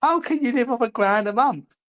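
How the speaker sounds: high, incredulous mocking voice; sneering falsetto disbelief. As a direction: squeak it out, scoffing. Ridiculing living on a grand.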